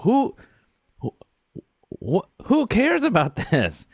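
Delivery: high, stammering mock bafflement; exasperated disbelief. Talking to a book at home, baffled and exasperated. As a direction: stumble on the first word, then pitch it high. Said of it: "talking to a book".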